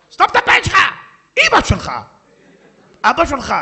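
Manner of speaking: enraged wife shrieking insults at her husband; furious wife. Acted. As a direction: tear into him at full volume.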